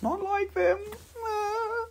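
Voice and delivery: tiny, very high, soft whimper; mock pout. Seller whose pieces go unbought, mock pout. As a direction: whine softly as if hurt, playfully. Pouting at buyers not buying.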